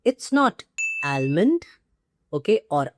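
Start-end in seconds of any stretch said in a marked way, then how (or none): 1.0-1.7 "almond" as AL-mund, l sounded, rising; short "a" and audible l in "almond"; incorrect pronunciation. Shown as a demonstration. Teacher listing a wrong form, "almond" with sounded l. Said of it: "two incorrect pronunciations".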